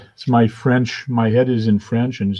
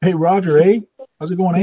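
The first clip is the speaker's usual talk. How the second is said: higher and louder, hamming up the Canadian eh; playful Canadian; 9 semitones higher, 5 dB louder, faster than usual. Pretend. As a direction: greet him with an exaggerated Canadian eh. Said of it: "put an A on the end of every sentence".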